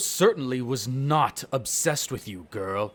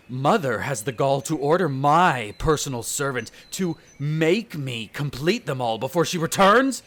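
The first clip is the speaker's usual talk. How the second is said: louder, climbing fury at each insult; indignant rant; 3 semitones higher, 6 dB louder than usual. Acted. Vampire fuming to the human about his mother's orders, furious rant. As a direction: spit out every complaint about Mother.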